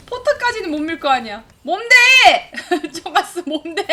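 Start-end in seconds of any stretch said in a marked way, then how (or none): 1.6-2.5 loud startled call; sharp yell of alarm; sudden shout. Real, not acted. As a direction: shout it out at the screen. Streamer yells at teammate.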